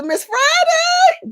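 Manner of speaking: name sung out high and slow, stretched long; drawn-out shoutout. Real, not acted. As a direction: call her name up high like a joyful shoutout. Thanking a donor on stream.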